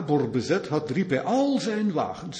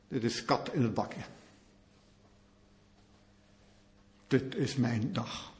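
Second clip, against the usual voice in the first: Sisera's inner gloat; 6 semitones lower, 9 dB quieter than usual. Acted. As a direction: think it quietly to yourself, savouring. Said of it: "En hij denkt".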